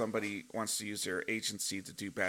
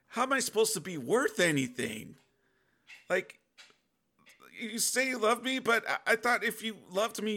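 upset questioning; 7 semitones higher than usual. Retold or imitated. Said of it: "I start getting upset".

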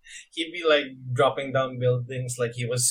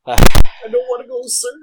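high loud desperate cry; loud desperate plea; 11 semitones higher, 20 dB louder than usual. Retold or imitated. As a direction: shout it like pleading not to die. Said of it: "favorite scene when he got snapped".

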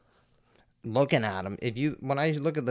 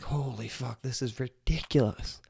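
hushed disbelief; 6 dB quieter than usual. Retold or imitated. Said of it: "and I'm like".

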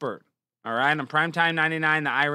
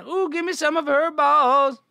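high, loud hammed-up drooling voice; mock lust; 9 semitones higher, 4 dB louder than usual. Streamer pretends to drool over a photo, high and loud.

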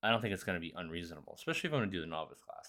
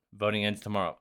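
ordinary delivery

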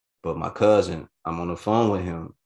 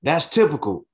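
dismissive retort; 8 semitones higher, 6 dB louder than usual. Retold or imitated. Girlfriend's retort at dinner.

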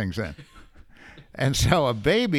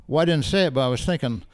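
ordinary delivery

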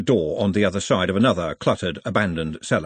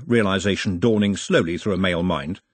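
usual speaking voice

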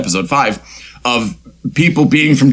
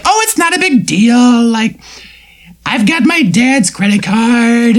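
put-on much higher, bratty rich girl; hammed-up airy valley girl, much higher; 9 semitones higher than usual. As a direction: toss it off like a spoiled teen. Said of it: "What did I say about voices?".

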